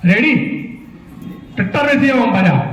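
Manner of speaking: ordinary delivery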